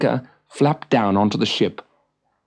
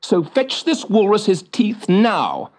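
stern; 7 semitones higher than usual. Acted. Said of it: "said Fricka sternly".

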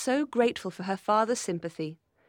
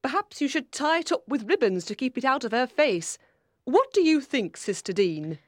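lightly voiced critical aunt; a hint of fussy disapproval; fault-finding aunt; 5 semitones higher, 4 dB louder than usual. A narrator's reading. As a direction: offer pointed advice to a sister.

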